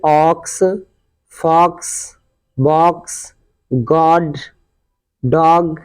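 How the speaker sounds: "ऑक्स", "फॉक्स", "बॉक्स" slowly, one by one, with open aw; open aw sound. Shown as a demonstration. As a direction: model "गॉड" and "डॉग" with a rounded aw, pausing between words. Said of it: "इसका उच्चारण अ निकलता है". ("ऑक्स", "डॉग" में ऑ का खुला उच्चारण)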